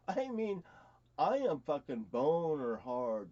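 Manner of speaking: ordinary delivery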